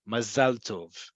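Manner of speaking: "mazal tov" slowly, with stress on the "-zal"; modern Hebrew pronunciation. Shown as a demonstration. Teacher models "mazal tov" for learners, stressed ma-ZAL TOV. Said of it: "this is pronounced".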